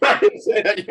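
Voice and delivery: high, laughing, half in tears; laughing denial. Real, not acted. Host caught out by friend, laughing denial. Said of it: "I'm getting emotional".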